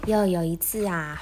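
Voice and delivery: ordinary delivery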